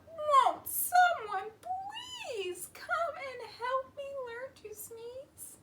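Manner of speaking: slow, high, tearful plea fading away; crying out. Acted. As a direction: wail it, then let it trail off. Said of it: "The goat cried out".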